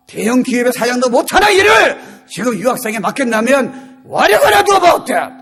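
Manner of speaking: high, ringing preacher's shout; impassioned challenge. Real, not acted. Preacher challenging students given God's last-days task, impassioned shout. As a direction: build each phrase like a rallying charge.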